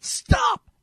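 very high, loud imitated shout; shouted command. Retelling the warning a missionary heard while driving, shouted. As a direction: belt it out as an abrupt warning. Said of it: "but shouted".

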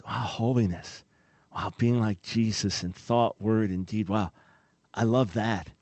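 low, soft, wondering voice; hushed delight, as if savouring the idea; acted awe. Believer who loves being like Jesus, acted awe. Voicing a believer welcoming holiness.

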